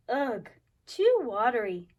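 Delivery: usual speaking voice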